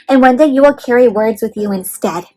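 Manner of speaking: usual speaking voice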